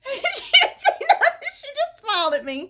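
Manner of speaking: high-pitched laughter through the words; can't stop laughing as you tell it. Real, not acted. Laughing while retelling.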